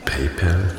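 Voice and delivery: PayPal with a short "pel" ending, softly; quiet, tentative PayPal ending in "pell"; trying out pronunciation. Shown as a demonstration. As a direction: try "PayPal" as "pay-pell", unsure. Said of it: "wie man es richtig ausspricht".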